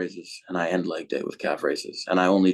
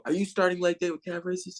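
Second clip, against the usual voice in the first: mocking coach; 10 semitones higher, 5 dB quieter than usual. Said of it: "used to make fun of me".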